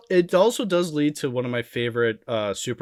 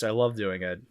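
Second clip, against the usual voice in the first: usual speaking voice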